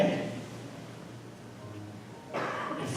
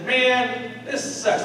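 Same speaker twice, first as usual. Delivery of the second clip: lightly voiced grumbling crab, higher pitch; mildly exasperated complaint, pitched up; fed-up crab; 8 semitones higher, much slower than usual.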